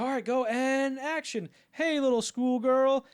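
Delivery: high, loud, slow sing-song; take call. Retold or imitated. Crew starting the take, high hammed line. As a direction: call action then ham the line up high.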